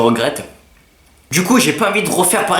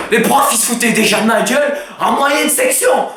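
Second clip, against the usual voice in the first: mock indignation; 5 semitones higher than usual. Real, not acted. Indignant outburst about teachers.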